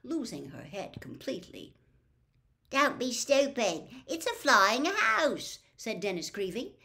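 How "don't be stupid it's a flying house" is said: scornful. A narrator's reading. Boy scoffing at the dragon guess.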